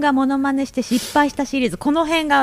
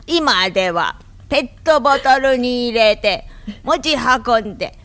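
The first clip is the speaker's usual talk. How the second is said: put-on slow, drawn-out old lady testimonial; green-juice commercial granny; slower than usual. Guest parodying a green-juice commercial for the host, slow granny testimonial. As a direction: imitate an amateur granny in an advert. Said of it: "ミラクルひかるの青汁のおばあちゃん".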